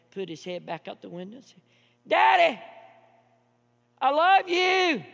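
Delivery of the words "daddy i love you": put-on high, loud, slow child's yell; shouting with all his energy. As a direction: throw it down the street with everything. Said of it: "mustered up all the energy he had".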